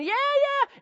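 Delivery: put-on high, loud, squealing English toddler's yeah; very high excited kid cheer. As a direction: squeal like a thrilled two-year-old. Toddler excitedly running around.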